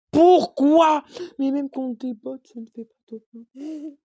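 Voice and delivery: very loud, high "Pourquoi?" into a whine; exasperated shout. Real, not acted. Gamer yells high and loud at losing even against bots. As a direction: cry out in disbelief, then sulk.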